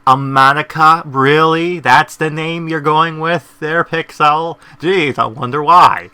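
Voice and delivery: loud mocking disbelief; incredulous. Real, not acted. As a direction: scoff at the silly name. Mocking the made-up name.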